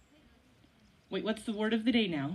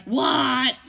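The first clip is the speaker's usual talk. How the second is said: mock yell; 5 dB louder than usual. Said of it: "you just yell from the couch".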